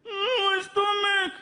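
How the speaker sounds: put-on high, slow, crying child voice; whimpering kid. Frankie sick from cake, slow high whimper.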